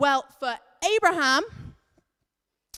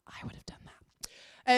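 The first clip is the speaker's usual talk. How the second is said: whispered aside. Real, not acted. Aside confessing to kids.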